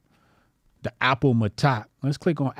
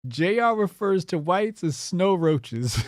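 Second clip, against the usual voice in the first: slow, higher, stifling laughter; slow dramatic reveal; 5 semitones higher, slower than usual. Shown as a demonstration. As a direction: build up to the punchline slowly. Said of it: "slow dramatic read".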